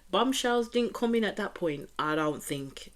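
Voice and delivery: ordinary talk, nothing marked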